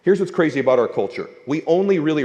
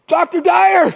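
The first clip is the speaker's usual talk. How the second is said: high excited call of Doctor Dyer; 6 dB louder, much slower than usual. Retold or imitated. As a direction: call out to a hero jogging past. Calling out to his hero.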